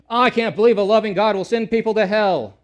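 loud, high-pitched protest of the doubters; mock outraged objector. Skeptics loudly protesting to the preacher, an indignant shout. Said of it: "there are people saying".

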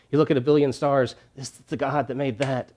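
usual speaking voice

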